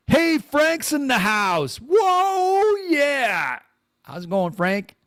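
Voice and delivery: high, slow, loud cartoon hello; a loud put-on squeal for a viewer; goofy greeting voice. Greeting a live-chat viewer.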